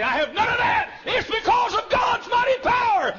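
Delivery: rising to a high, loud preacher's shout; preaching at full force. Real, not acted. Preacher to congregation, building to a full shout.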